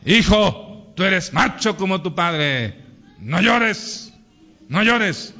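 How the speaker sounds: acted macho father ordering his son; stern father. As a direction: lay down the law to a boy. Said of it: "El hombre dice". (padre machista regañando al hijo)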